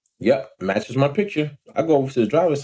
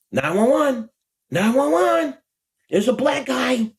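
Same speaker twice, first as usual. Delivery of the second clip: high-pitched panicky 911 caller impression; mock alarmed caller; 9 semitones higher, slower than usual. Mocking dudes who call the cops, high alarmed imitation. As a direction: mimic someone urgently reporting a threat.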